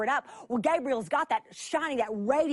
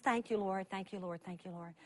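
mumbled rote prayer; 6 semitones lower, 10 dB quieter than usual. Retold or imitated. Routine prayer mumbled and fading.

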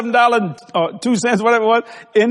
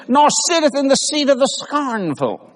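scripture reading; 4 semitones higher, slower than usual. Real, not acted. Quoting the psalm, preaching.

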